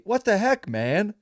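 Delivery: high whiny cry of outrage; whiny yell at the other driver; whiny loser yell. Retold or imitated. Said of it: "All whiny like that".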